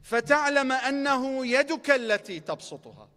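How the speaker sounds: rapid recited Arabic quote; quick Arabic recitation. Acted. Preacher quoting the Imam's Arabic to the crowd, rapidly recited. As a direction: rattle off the source text.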